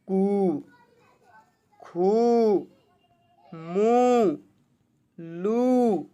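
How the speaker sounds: कु, खु, मु, लु with the "oo" held long and level; drawn-out long vowel. Shown as a demonstration. Teacher showing pupils how to read long oo, drawn out. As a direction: stretch the vowel in each of कु, खु, मु, लु. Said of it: "खींच के पढ़ेंगे".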